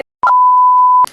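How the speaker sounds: sharp high startled gasp; loud gasp. Real, not acted.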